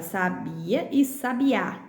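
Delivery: "sabia" stressed on bi, "sabiá" on final á; teacher contrasting sa-BI-a with sa-bi-Á. Shown as a demonstration. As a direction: draw out bi in "sabia", then á in "sabiá". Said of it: "porque tem acento".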